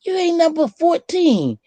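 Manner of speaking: high, put-on girlish retort; mock teen sass. Girl answering back about her concert, high and sassy. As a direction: pitch it way up like a sassy fourteen-year-old.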